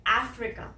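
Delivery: 'अफ्रीका' as AF-ri-ka, open first vowel, short 'ri'; correct pronunciation. Shown as a demonstration. Teacher gives learners the correct model, careful English 'अफ्रीका'. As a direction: stress the opening 'ae' of 'अफ्रीका'. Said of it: "इसकी जो correct pronunciation है".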